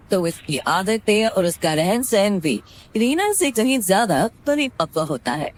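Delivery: ordinary delivery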